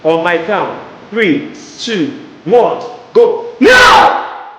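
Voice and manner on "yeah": high rising yell; battle shout. Real, not acted. Coach shouting with students on the count, full yell. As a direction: throw the shout out. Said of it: "scream that".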